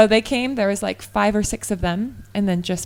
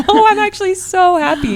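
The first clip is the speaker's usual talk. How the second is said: excited protest; 11 semitones higher, 9 dB louder than usual. Retold or imitated. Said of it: "I'm like".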